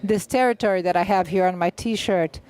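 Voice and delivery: ordinary delivery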